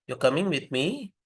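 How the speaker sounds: "You're coming with me" rising sharply at the end; interrogative intonation. Shown as a demonstration. Lecturer shows a statement becoming a question, interrogative. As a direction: ask "You're coming with me" with a final lift. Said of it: "it becomes a question".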